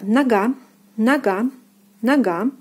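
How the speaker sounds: нога as "na-GA", о turned to "a"; careful model нога for learners; stress on second syllable. Shown as a demonstration. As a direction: stress the end of нога, first vowel "a". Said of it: "The second syllable is stressed and O is pronounced as A".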